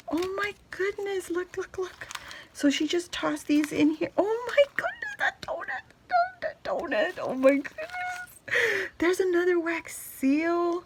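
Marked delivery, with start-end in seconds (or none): 4.2-8.9 breathy gasp rising into a high squeal; can barely get the words out for joy; squealing over a donut; excited squeal. Real, not acted. Opening a gift box, thrilled.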